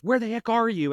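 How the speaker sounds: impatient boss. Retold or imitated. Boss phones, impatient early call.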